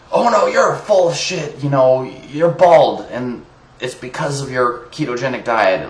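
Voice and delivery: high-pitched mock outraged commenter; mocking imitation. Ranter mimicking Ray Peat fans' comments, mocking. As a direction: start high and whiny, parodying an accuser.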